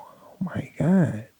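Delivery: soft whispered disbelief; hushed whispered aside. Retold or imitated. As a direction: breathe it out in awe. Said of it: "I go".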